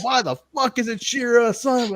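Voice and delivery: high-pitched retold exasperation; worked-up rant. Ranting to co-hosts about the Shira storyline, worked up. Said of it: "I'm all worked up".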